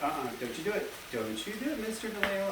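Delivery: lightly voiced finger-wagging scold; hint of a stern telling-off; scolding copyright warning.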